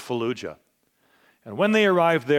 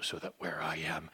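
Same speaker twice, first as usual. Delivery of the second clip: much softer, low and hushed; 9 semitones lower, 15 dB quieter than usual. Acted. Preacher quotes Jesus to the congregation, hushed and soft. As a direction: start the repetition almost under your breath.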